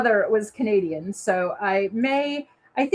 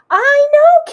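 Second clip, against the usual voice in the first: excited; 12 dB louder than usual. Real, not acted. Excited reply to a viewer comment.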